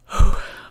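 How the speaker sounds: a loud heavy sigh, gathering yourself; real sigh of being moved; emotional sigh. Real, not acted. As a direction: let the breath out before going on. Moved host pauses mid-sentence.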